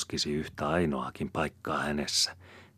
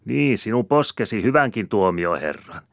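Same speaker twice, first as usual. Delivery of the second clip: high, loud needling; mocking taunt; 7 semitones higher, 9 dB louder than usual. A narrator's reading. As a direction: scold and jeer at once. Woman continues her taunt.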